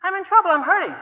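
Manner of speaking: high, loud panicked plea; desperate cry. Pretend. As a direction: cry out in pain and fear. Desperate cry voiced, much higher.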